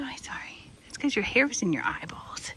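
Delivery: soft unvoiced whisper to a startled horse; whispered soothing. Real, not acted. Owner apologizing to a horse she startled, gentle whisper. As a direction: hush it gently so he calms.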